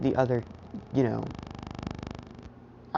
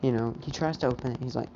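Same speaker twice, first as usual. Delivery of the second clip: ordinary talk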